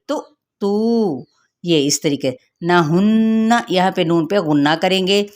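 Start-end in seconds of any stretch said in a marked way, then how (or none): 0.1-1.3 तू drawn slowly, held one alif length; stretched vowel. Shown as a demonstration. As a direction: hold तू long and smooth. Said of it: "एक अलिफ के बराबर खींचेंगे".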